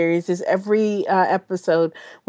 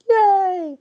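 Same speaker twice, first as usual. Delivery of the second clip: excited cheer; 12 semitones higher, 4 dB louder than usual. Real, not acted. Cheering the Halloween episode.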